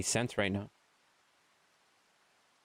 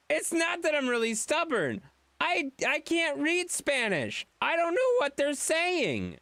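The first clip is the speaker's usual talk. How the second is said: voiced dog. Voicing the dog's reply.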